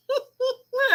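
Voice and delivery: high shriek of laughter. Real, not acted. Reacting to a comment.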